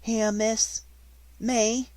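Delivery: put-on high, loud, astonished servant girl; can't believe the invitation; amazed maid asked to stay, high and loud; breathless surprise. A narrator's reading. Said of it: "Becky lost her breath again".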